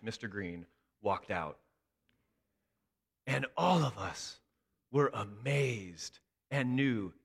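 ordinary talk, nothing marked

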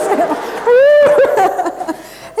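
loud, high burst of relieved laughter; big joyful laugh. Real, not acted. Preacher laughing with relief the man was healed, loud joyful laugh. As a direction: let the relief explode into laughter.

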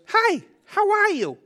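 very high, slow sing-out greeting; pep up like a delighted toddler at the mirror; imitating toddler son. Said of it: "It's exactly how he says".

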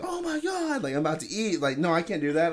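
high-pitched, appalled refusal; mock horrified protest. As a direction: imitate a coworker recoiling in disgust. Coworker recoiling in disgust, acted.

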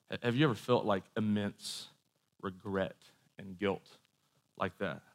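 subdued, reflective appeal; solemn questioning. Real, not acted. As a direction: let each word sink in, low and hushed. Preacher asks listeners about shame, solemnly.

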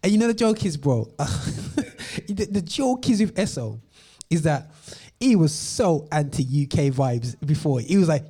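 ordinary talk

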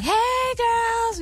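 very high, sugary hello; imitating a sweet, high-pitched star; sweet, uppity greeting. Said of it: "just sweet, uppity, you know".

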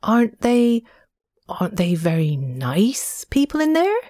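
a trifle nervously. A narrator's reading. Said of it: "a trifle nervously".

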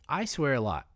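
ordinary talk, nothing marked

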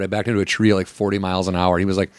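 normal talking voice

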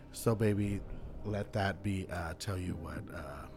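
usual speaking voice

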